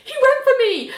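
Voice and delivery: high-pitched, indignant squeal; mock defensive protest. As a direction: protest high, in a put-on fluster. Nell defends herself in retold quarrel.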